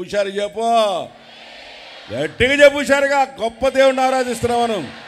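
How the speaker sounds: loud call. Shown as a demonstration.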